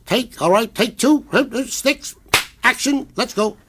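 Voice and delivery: high, brisk call of the take; director calling a take. Retold or imitated. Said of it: "and he says".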